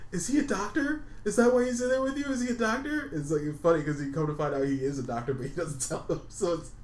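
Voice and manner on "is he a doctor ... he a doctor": high, puzzled mock questioning; confused guy. S0 voicing the date asking if the man is a doctor, high and puzzled.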